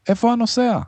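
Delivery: very high urgent question; alarmed shout. A narrator's reading. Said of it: "צעק". (שאלה בצעקה גבוהה)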